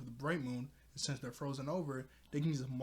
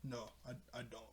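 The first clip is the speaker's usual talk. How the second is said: much softer, halting reply; sheepish admission; 10 dB quieter than usual. Retold or imitated. Bo admits he doesn't know them, sheepishly. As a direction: quietly own up, imitating embarrassment.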